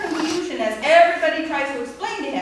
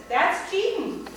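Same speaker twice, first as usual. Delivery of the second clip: indignant accusation; 6 semitones higher, slower than usual. Retold or imitated. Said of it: "he says".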